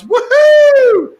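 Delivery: high, loud mock whoop of glee; excited whoop. Brain cheering at a problem to solve, excited whoop. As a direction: whoop it like an eager brain set loose. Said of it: "your brain goes".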